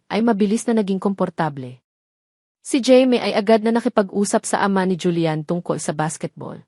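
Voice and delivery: plain reading voice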